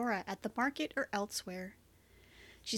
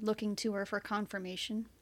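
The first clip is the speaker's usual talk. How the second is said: usual speaking voice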